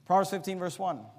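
normal talking voice

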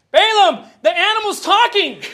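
high, loud mock exasperation; exasperated shout. Preacher yelling at Balaam about the talking animal, exasperated. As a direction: shout it as if stating the obvious.

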